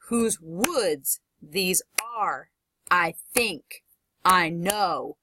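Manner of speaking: slow pairs, punching "woods", "are", "think" and "know"; stress on second word. Shown as a demonstration. Teacher demonstrates iambic feet, slow with weight on "woods", "are", "think", "know". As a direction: lean hard on "woods", "are", "think", "know", pausing after each. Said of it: "the word that has the punch is the second word".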